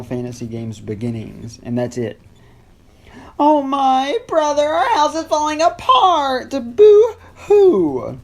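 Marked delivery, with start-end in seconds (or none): 3.4-6.5 very high, loud sobbing sister; fake crying girl voice. 6.5-8.2 very high, loud "Boo-hoo!" sobbed out; fake boo-hoo cry.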